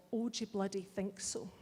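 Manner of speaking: ordinary talk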